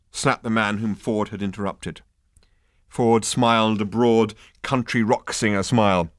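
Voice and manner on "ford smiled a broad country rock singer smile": plain reading voice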